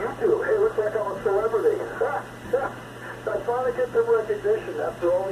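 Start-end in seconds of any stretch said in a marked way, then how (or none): 1.9-3.2 quick amused chuckle at himself; a brief real laugh, half to himself; short laugh. Real, not acted. Laughs about becoming a YouTube celebrity.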